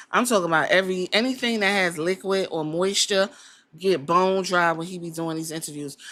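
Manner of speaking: ordinary talk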